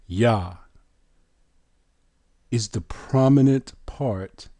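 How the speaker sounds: normal talking voice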